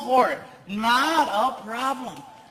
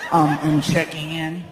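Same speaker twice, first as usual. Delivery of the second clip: low, meek mumble; timid reply; 8 semitones lower than usual. Retold or imitated. Comedian answering the grumpy clerk, low and meek. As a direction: shrink back and answer meekly.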